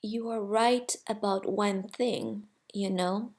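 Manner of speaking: hushed, soft and serious; quiet voice. A narrator's reading. The friendly soul quietly telling the little soul, softly. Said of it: "said in a quiet voice".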